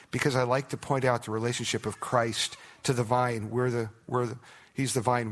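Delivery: ordinary talk, nothing marked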